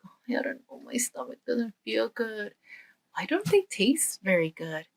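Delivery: soft, uneasy murmuring whisper; queasy whisper. Acted. Guilty boy at dinner feels unwell, whispered unease. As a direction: complain under your breath of a bad stomach.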